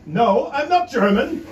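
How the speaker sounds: mildly offended insistence; indignant correction. Retold or imitated. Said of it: "she goes everywhere saying".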